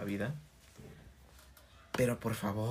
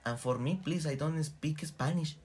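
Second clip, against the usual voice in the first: higher, slow, polite tourist English; mock gringo dad; 5 semitones higher, slower than usual. Tourist dad asking the dolphin trainer for English, mock high slow. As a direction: ask sweetly for English, slowly.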